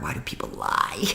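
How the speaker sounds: breathless whisper of anguish; whispered despair. Retold or imitated. Retelling her breakdown after the call, whispered anguish. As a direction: hush it out like a plea. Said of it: "I cried and I screamed".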